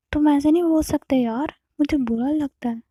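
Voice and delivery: hurt protest. Acted.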